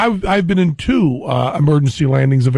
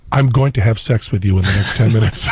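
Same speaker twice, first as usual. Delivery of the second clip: laughing through it; 7 semitones lower than usual. Retold or imitated. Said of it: "turn to him and go".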